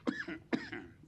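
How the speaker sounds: hacking cough, throat blocked; choking cough. Real, not acted. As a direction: cough like something went down wrong. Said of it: "Oh, I'm choking".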